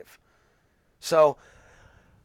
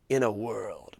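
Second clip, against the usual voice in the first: low, soft dramatic trailer narrator; the classic movie trailer intro; old movie trailer voice; 5 semitones lower, 6 dB quieter, slower than usual. Retold or imitated. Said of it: "the old movie voice".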